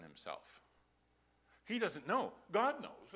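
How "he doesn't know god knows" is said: raised, higher declaration; a loud preacher's declaration; emphatic preaching. Real, not acted. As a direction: proclaim it loud and high.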